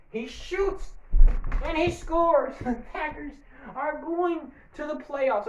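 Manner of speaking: high, drawn-out sportscaster call; announcer voice. Acted. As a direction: call the shot like a TV announcer, slowly. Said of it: "I don't know why I'm talking like this".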